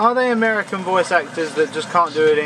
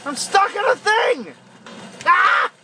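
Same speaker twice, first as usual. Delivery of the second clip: panicked; 9 semitones higher than usual. Real, not acted. Stuck while monster attacks.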